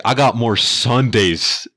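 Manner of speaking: boastful comeback. Retold or imitated. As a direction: lean into every word like a veteran mic drop. Dad's comeback to the trucker.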